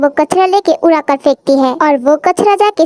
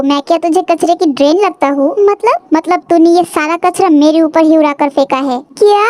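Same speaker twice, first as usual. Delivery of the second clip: usual speaking voice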